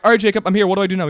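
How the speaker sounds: loud, high frantic call for help; retold panicked yelling. Gamer yelling to friend mid boss fight, loud and frantic. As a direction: yell at your friend in a panic. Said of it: "just screaming at each other".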